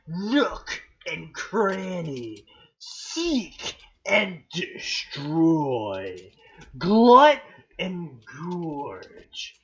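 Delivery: slow, grandly proclaimed weapon names; theatrical, drawn-out battle cries; dramatic announcer. Pretend. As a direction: announce each pair like legendary weapons.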